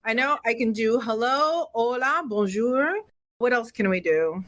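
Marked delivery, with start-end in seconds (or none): none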